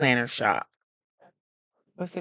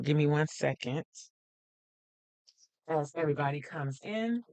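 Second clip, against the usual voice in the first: a little winded after running up stairs; out of breath; 4 dB quieter than usual. Real, not acted. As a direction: breathe between words, slightly winded. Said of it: "a little out of breath 'cause I just ran up the stairs".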